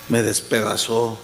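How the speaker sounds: confesión dolida en voz muy grave; lloroso, imitado. Retold or imitated. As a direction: imita a un hombre abatido, en tono bajo. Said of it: "estaban llorando los dos". (imitando pesar, con voz apagada y baja)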